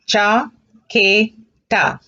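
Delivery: chaqueta drawn out very slowly; very slow model. Shown as a demonstration. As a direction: stretch chaqueta syllable by syllable. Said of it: "Look at that carefully".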